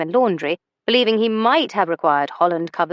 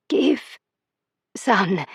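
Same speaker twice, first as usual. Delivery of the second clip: effortful speech. A narrator's reading. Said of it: "worked on speaking again".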